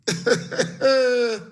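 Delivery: high, mocking burst of laughter; scornful laugh. Real, not acted. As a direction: laugh derisively at your opponents. Scornful laugh amid threats to opponents.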